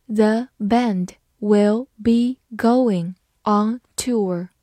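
very slow, word by word with pauses; slow reading. Shown as a demonstration. English teacher models the new sentence for learners, slow reading. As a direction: read it slowly, separating each word. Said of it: "好，慢慢来读".

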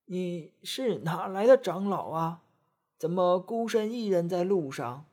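a hint of a kindly old woman. Old woman voiced in story.

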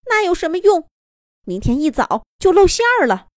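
high, bright little rabbit. A narrator's reading. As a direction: squeak it out like a small rabbit. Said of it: "小兔子好像说了一句". (小兔子的高声)

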